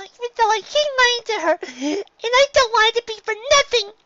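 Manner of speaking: put-on high child voice, near tears; tearful little girl. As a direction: plead like a crying child. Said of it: "tears in her eyes".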